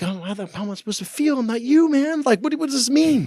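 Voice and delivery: a husband baffled by talk of feelings, voice shooting up; exasperated. Retold or imitated. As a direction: throw up your hands, baffled and high. Exasperated retort to wife.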